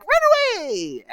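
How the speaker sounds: very high squeaky cry to flee; squeaky goblin yell. Retold or imitated. Goblin telling everyone to flee, high squeak. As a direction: shriek it like a panicked goblin. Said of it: "I used all of the squeaky sounds".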